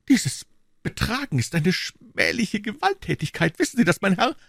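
high, indignant rebuke; indignant queen. A narrator's reading. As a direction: scold him with royal indignation.

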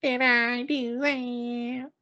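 high, slow nasal catchphrase; Steve Urkel. Retold or imitated. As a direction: squeak it slowly, nerdy and high. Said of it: "Carl Winslow".

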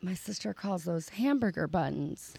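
soft, slow retold aside; confidential aside. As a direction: lean in and say it low-key. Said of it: "lean into Rick and I'm like".

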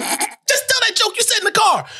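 high-pitched mock yell; urgent coaching. Friends in front row urge the frozen comic, urgent squeal. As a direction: egg on a panicking friend in a squeal. Retold urging of freezing comic.

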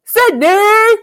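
high, loud yelling of a name; desperate yell. Retold or imitated. Arrested Billy calling to Sydney, loud desperate yell. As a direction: cry the name out as he's dragged off. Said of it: "the way that this, it sounds is really funny".

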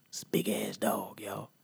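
soft hushed confiding; whispered aside. Acted. Telling the crowd about the dog in a whisper. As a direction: whisper it to the room.

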